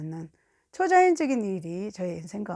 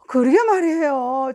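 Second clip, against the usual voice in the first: exasperated agreement; 3 semitones higher, 7 dB louder than usual. Retold or imitated. Friend admitting she can't do it, exasperated. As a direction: let out a mock-frustrated wail of agreement.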